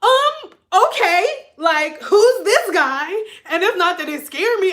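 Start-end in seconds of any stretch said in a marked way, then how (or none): 0.0-3.1 high, slow, loud bewildered drawl; taken aback. Retold or imitated. Sister stunned by her brother's outburst, slow high bewildered. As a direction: stretch it out in disbelief. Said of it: "I was like".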